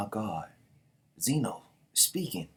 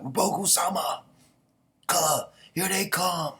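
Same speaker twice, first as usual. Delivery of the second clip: high, loud, stretched-out alarm; urgent warning call; 9 semitones higher, 5 dB louder, slower than usual. Acted. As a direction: call out like a soldier sighting attackers. Soldier warns of attackers.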